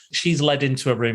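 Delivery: ordinary talk, nothing marked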